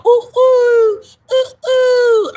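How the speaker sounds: high, hooting mating-bird call; imitated bird call. Streamer imitating mating birds, high sustained hoots. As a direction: hoot like a bird calling its mate. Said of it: "And they keep going".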